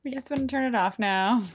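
scared voice begging to quit; panicked. Retold or imitated. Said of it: "we both started to panic".

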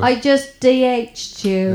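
put-on slow, drawn-out British accent. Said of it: "amazing British accent I've ever heard".

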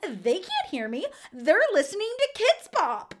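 high, loud mocking squeal; mock crazy mom. Kidz Bop mom gloating about the kids' music, high and loud. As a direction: play the unhinged mom gloating. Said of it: "She goes".

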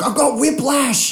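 high, slow mock wail; mock complaint. Other driver after a tiny bump, hammed-up complaint. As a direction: imitate an overdone injury claim.

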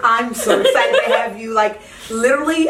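bubbling over with glee; joyful reunion. Real, not acted. Host greets guest, laughing.

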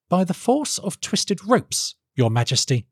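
high, respectful inventor; earnest inventor. Acted. Felix answers the king.

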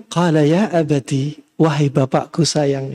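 low, slow, affectionate call to father; gentle, tender address. Acted. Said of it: "yang lembut, panggilan lembut". (panggilan lembut penuh sayang, rendah dan pelan)

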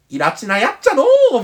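a touch of playful Kansai griping; mock exasperation. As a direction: exclaim it in hammed-up Kansai dialect, stretching the last syllable. Acts out an exasperated dialect remark.

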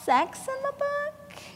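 mock sweet insinuating agent; coy ask. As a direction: ask delicately, pitch rising. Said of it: "And so she says".

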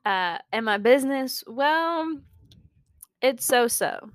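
usual speaking voice

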